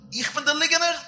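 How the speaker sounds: high, loud, indignant challenge; outraged question. Acted. Father asking his son if he is the liar, outraged shout. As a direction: throw the accusation back, shouting. Acted quarrel, father accused of lying.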